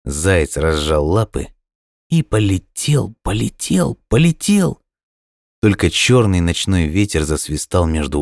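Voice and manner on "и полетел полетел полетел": drawn-out start, lifting higher and higher; rising repetition. A narrator's reading. Narrator follows the falling hare, building. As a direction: pitch climbing with each repeat.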